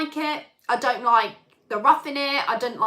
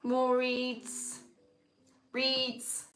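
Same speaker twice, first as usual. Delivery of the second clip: mock bored commentary; much slower than usual. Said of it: "you're like".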